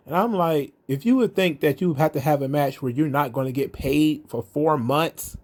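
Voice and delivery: ordinary delivery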